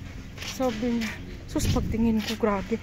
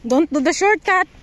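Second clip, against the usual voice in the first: raised voice, stress on shortcut; calling out; 5 semitones higher, 8 dB louder than usual. Real, not acted. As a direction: call it out across the path. Calling out to child on path.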